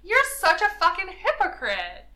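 high, loud accusation; indignant outburst. Acted.

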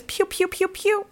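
high pitched pew pew gun noises; playful toy-gun sound effects; mock gunshots. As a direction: fire off finger-gun pews way up high. Describing Santa shooting family.

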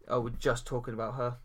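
normal talking voice

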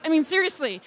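usual speaking voice

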